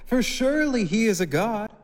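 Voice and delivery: high, loud sarcastic teasing; mock the priests with loud high sarcasm; scoffing. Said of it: "he scoffed".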